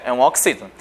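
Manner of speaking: usual speaking voice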